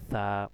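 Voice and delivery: "সা" with tongue tip at the front teeth, air escaping. Shown as a demonstration. Teacher showing tongue placement, "সা" airy and drawn out. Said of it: "সামনের দাঁতের আগার মধ্যে লাগবে".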